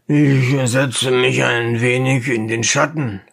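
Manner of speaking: a hint of a smug townsman; dentist Struzion. A narrator's reading. Dentist explains himself to the driver, lightly voiced.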